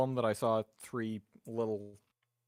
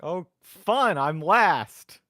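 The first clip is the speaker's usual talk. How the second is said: sarcastic; 6 semitones higher, 11 dB louder, much slower than usual. Real, not acted. Player reacts to going last.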